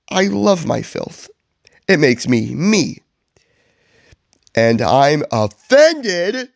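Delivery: indignant, hammed-up Grinch; theatrical affronted villain, not truly shouting; offended mock yell. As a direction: declaim the outrage with swooping pitch. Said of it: "yells back".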